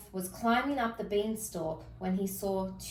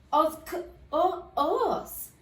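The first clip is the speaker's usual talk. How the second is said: high, stuttering, scared voice; frightened stammer; 7 semitones higher, 3 dB louder, slower than usual. Acted. Cinderella stammering at the wolf, high frightened stutter. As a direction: stumble over the word in fright. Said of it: "stammered a frightened Cinderella".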